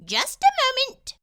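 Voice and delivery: very high, loud squeaky mouse voice; a tiny polite mouse dentist; little mouse speaking up. A narrator's reading.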